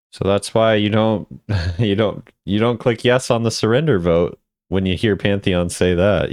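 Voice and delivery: ordinary talk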